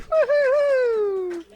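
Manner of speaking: very high, loud, drawn-out whoop; celebratory high hoot; whooping cheer. Real, not acted. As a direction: whoop long and loud for the performer. Cheering for the previous speaker.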